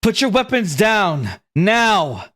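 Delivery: high, commanding call of an orc leader; shouted command from the gate; calling out an order. Acted. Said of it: "Glue calls out to Nizora".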